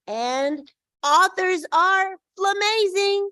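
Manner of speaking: excited cheer. Real, not acted. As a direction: stretch it out like a cheer. Cheerful sign-off praising authors.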